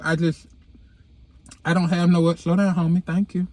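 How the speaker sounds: normal talking voice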